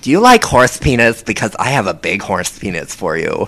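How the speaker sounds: over-the-top effeminate unicorn voice. Pretend. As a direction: gush like a flamboyant, campy character.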